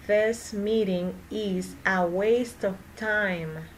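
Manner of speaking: slow, careful, words spaced out; slow practice sentence; slow repeat. Shown as a demonstration. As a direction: model it slowly for learners. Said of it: "teacher repeats sentence slowly for practice".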